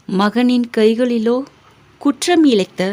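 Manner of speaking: ordinary delivery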